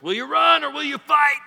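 high, loud battle cry; rally the troops like Wallace; William Wallace voice. Acted. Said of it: "in a William Wallace type voice".

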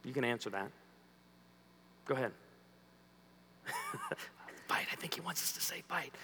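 sly whispered aside; confiding whisper. Real, not acted. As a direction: whisper it to the crowd. Aside to audience.

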